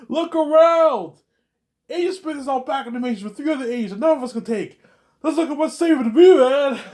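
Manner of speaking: high loud laughing yell; laughing shout. Acted.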